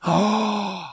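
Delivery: high, drawn-in gasp of mock shock. Hearing someone got offended over the accent, mock shocked gasp. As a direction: gasp as if scandalized.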